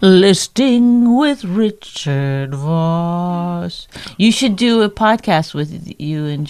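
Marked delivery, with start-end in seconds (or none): 0.0-3.9 very slow, drawn-out mock announcement; mock show title. Co-host mocks partner for listing everything, mock show title. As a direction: stretch it out like a teasing show intro.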